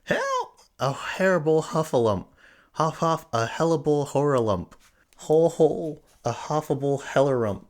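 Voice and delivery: very high, loud, tongue-tied terror; panicked cry. A narrator's reading. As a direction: shriek and jumble the words in panic. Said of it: "still crying out".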